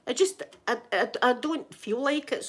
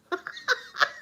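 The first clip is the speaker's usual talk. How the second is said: high, breath-filled giggle; real laugh; 10 semitones higher than usual. Real, not acted. Recalling bragging in the ambulance, giggling high. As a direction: let out a high airy laugh.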